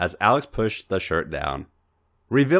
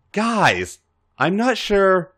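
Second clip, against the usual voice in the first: raised, hesitant protest; nervous, higher voice; 10 semitones higher, much slower than usual. Acted. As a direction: sound unsure and higher. Unsure character protesting.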